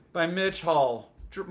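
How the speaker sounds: ordinary delivery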